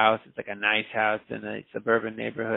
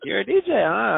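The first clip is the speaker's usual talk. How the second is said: cousin's teasing voice; 8 semitones higher, 5 dB louder than usual. Retold or imitated.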